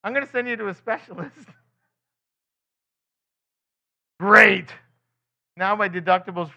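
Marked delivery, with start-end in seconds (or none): 0.0-1.3 doctor. Retold or imitated. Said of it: "He's going". 4.2-4.7 sarcastic. Acted.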